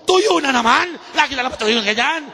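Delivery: acted angry outburst, high and loud; high-blood father. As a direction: blow up like an exasperated father. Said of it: "Yun, high blood". (galit na tatay, kunwaring na-high blood)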